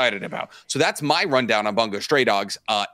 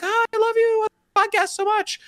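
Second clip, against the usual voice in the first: much higher falsetto fan gush; lady fan meeting the host. Retold or imitated. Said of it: "She's like".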